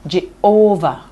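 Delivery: "Jehovah" with the middle "ho" stressed; "Jehovah" as weak, strong, weak; middle-syllable stress. Shown as a demonstration. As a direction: lean on the "ho" in "Jehovah". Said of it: "one stressed syllable between two unstressed".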